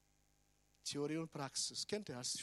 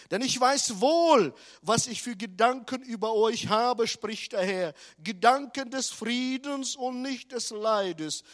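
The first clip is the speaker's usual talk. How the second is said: scripture proclamation; 4 semitones higher, 12 dB louder than usual. Acted. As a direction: declaim the verse with full force.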